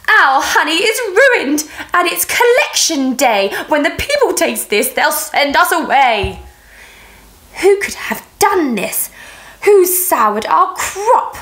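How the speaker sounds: queen bee screaming over ruined honey. Acted. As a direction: shriek it like the hive is ruined. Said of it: "the Queen let out a scream".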